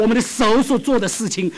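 normal talking voice

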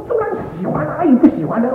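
high, eager retold answer; delighted reassurance. Son answers father about church, overjoyed. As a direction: jump at the chance to welcome father.

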